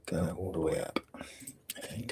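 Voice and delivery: soft whisper. Real, not acted. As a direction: breathe it out under the breath. Unvoiced whisper.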